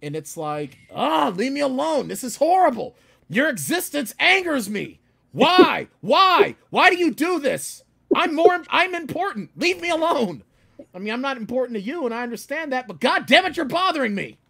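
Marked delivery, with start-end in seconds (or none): 0.9-10.4 loud, high exasperated ranting; hammed-up road rage shouting; mock yell. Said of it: "and I yelled at her". 10.9-14.4 exasperated rant. Retold or imitated. Continues rant at the driver.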